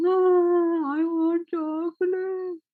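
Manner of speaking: high, slow mock wail of a little kid; imitating a small crying child, high and drawn out; whiny crying child. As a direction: whimper like a toddler who wants chocolate.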